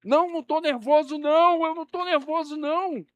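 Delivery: shouted high, insisting he is not nervous; a loud, strained yell of denial while clearly rattled; hammed-up nervous guy shouting he is calm; mock nervous denial. Man at surprise party insists calm.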